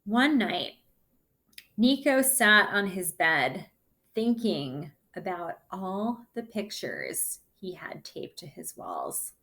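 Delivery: plain reading voice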